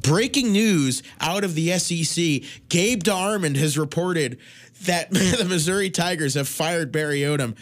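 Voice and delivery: slow TV anchor breaking-news read; Reese Davis announcing. Retold or imitated. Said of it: "I hear Reese Davis".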